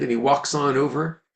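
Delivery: ordinary talk, nothing marked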